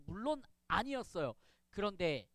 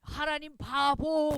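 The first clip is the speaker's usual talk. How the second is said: high, loud, drawn-out taunt; stretched-out mocking shout; mock distant jeer; 6 semitones higher, 8 dB louder, much slower than usual. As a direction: call the insult across a battlefield. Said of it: "저 멀리서".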